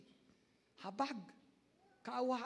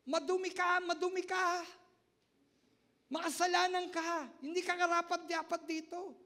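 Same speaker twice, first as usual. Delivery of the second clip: high, loud condemning voice of the crowd; scornful accusers; 10 semitones higher, 9 dB louder than usual. Acted. Pastor voicing people shaming a sinner, loud and scornful. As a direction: spit the insults like a judging crowd.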